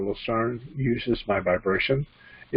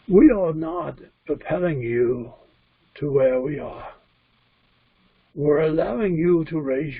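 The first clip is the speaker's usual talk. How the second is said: put-on higher-pitched channeled spirit with a British-tinged accent; put-on Scottish-English accent, higher and deliberate; Lucerne; 4 semitones higher than usual. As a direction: speak as an otherworldly teacher, pitched up and measured. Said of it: "My voice will change. You'll notice it will change".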